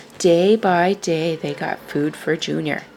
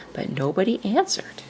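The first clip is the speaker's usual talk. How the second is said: plain reading voice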